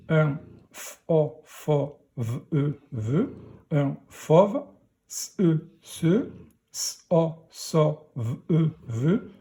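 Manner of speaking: slow phonics decoding, each sound apart, then blended as in titre and fauve; slow beginner reading, single sounds into syllables. Shown as a demonstration. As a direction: sound out journaux, titre, fauve, sauve, zoo letter by letter. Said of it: "pour la lecture de la phrase".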